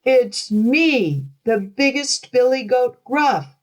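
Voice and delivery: high, bold billy goat shout. A narrator's reading. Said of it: "shouted the billy goat in a voice almost as loud".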